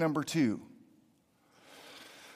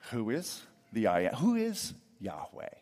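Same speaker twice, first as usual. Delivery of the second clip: pompous Pharaoh; 5 semitones lower, 5 dB quieter than usual. Acted. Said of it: "a pompous sense of smug arrogance".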